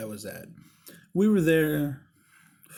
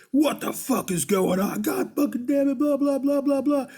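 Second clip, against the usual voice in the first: loud, high-pitched mock outrage; put-on furious tirade trailing into blahs; pretend pissed off; 11 semitones higher, 5 dB louder than usual. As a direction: storm in ranting, far higher than usual. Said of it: "I'm all fucking pissed".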